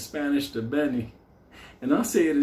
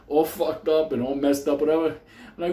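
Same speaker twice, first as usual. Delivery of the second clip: ordinary talk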